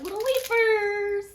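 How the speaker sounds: high and loud delight; light up at the sight; excited. Real, not acted. Excited at the chestnut cakes.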